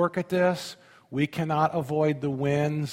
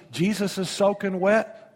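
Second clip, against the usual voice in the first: normal talking voice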